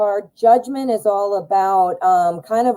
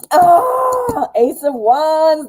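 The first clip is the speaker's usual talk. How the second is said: excited; 8 semitones higher, 4 dB louder, much slower than usual. Real, not acted. Delighted at a drawn card.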